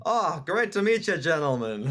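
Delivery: hammed-up Southern gentleman accent that slips toward Irish; Southern gentleman accent, overdone; attempted Southern gentleman accent. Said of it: "Southern gentleman accent".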